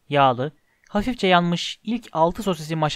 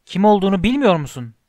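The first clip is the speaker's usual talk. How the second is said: higher, louder astonished question; raised-voice amazement; incredulous; 4 semitones higher, 6 dB louder than usual. Acted. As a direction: ask it in sheer disbelief. Giant incredulous at boy.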